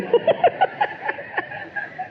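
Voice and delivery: high unvoiced giggle; a soft airy laugh; breathy laugh. Real, not acted. Laughs after confession. (tawa berbisik)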